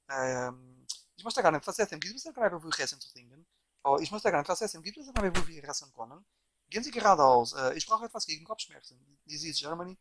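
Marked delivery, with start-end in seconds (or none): 1.2-7.5 quick-fire run of foreign sentences; fast German. Shown as a demonstration. Showing viewers he can speak any language fast, rapid German. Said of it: "I can speak fast any language". 7.8-9.0 quick, rattled-off German sentence; very fast German. Shown as a demonstration. Showing viewers how fast he speaks languages, rapid-fire German. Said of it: "if I speak it very fast, my accent is gone".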